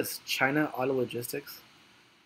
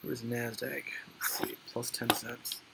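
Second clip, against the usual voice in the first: whispered, much softer; whispering; 8 dB quieter than usual. Real, not acted. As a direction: whisper it to yourself. Checking quotes under breath.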